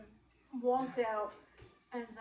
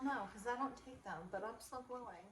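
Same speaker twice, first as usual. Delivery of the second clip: ordinary delivery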